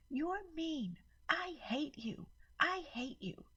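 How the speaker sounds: put-on high, far child's yell; a furious little girl at the door; screaming child. A narrator's reading. Maddie yelling at her aunt, high child voice. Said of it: "Maddie screamed at me".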